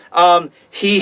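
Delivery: ordinary talk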